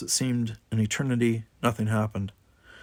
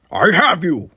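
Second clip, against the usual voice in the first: loud, higher reassuring shout; 7 semitones higher, 8 dB louder than usual. A narrator's reading. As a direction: shout down the shaft to him. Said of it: "Worf shouted down to him".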